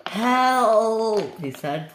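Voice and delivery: high, drawn-out call; cry for help. Acted. Flying Scotsman struggling to stay afloat, high cry for help. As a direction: call out as if sinking.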